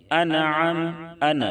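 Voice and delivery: انعم with the n sound turned back; incorrect, bounced n sound; wrong reading. Shown as a demonstration. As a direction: show the faulty returning n in انعم. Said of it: "یہ ادائیگی درست نہیں ہے".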